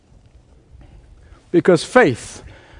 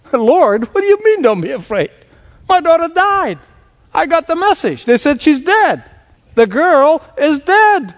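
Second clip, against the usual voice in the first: high, disbelieving protest; a stunned father protesting, pitched much higher; incredulous father; 10 semitones higher than usual. Acted. Jairus protests Jesus' words.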